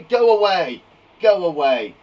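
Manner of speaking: loud, firm order; bossy command. Shown as a demonstration. Teacher showing pupils a command, bossy and loud. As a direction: order someone off forcefully. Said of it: "command if we're telling someone to do something".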